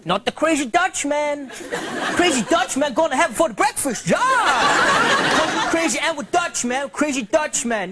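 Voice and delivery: hammed-up wild Dutch guy; lightly voiced crazy Dutchman whooping; mock Dutch accent. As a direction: brag like a reckless Dutchman. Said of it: "what the Dutch have for breakfast".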